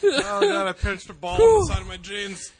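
laughing through the words. Real, not acted. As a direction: laugh while you talk. Laughing after pinching himself.

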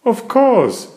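high, loud cheerful agreement; Mummy Pig. A narrator's reading. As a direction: answer brightly as Mummy Pig. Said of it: "says Mummy Pig".

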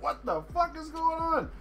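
high, incredulous mock outburst; mock disbelief. Grower recalling seeds sprouting in his beds, mock disbelief. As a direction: play it baffled and fed up. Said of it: "and I was like".

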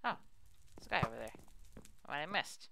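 ordinary talk, nothing marked

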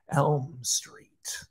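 ominous unvoiced whisper; a spooky hushed reveal. A narrator's reading. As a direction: breathe it like a secret.

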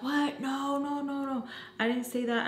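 high-pitched imitated protest; shocked denial. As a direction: act out a friend's high, indignant denial. Friend denies when accused.

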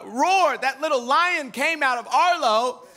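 ordinary talk, nothing marked

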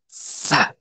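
Sa soplado, sin voz, con fuerza; sa con coraje. Shown as a demonstration. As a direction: suelta el Sa como un soplo valiente. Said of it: "el Sa es así como, sa, como con coraje". (Sa susurrado con coraje)

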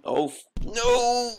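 high, slowed panicked cry of no. Retold or imitated. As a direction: voice the character's wail of dismay. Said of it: "He's going".

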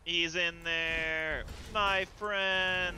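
drawn-out tease. Pretend.

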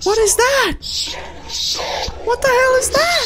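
high panicked shriek; freaked-out high-pitched yelp; scared horror game streamer. Real, not acted. Monster appears, horror game.

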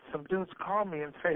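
usual speaking voice

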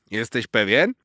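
a lightly voiced squirrel with a questioning rise; squirrel asking. A narrator's reading. Squirrel doubtfully asking the cat, lightly voiced. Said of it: "spytała wiewiórka Zosia".

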